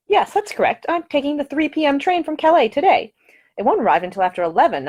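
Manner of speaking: put-on a polite, earnest young Englishwoman; young lady's voice. A narrator's reading. The lady answers brightly.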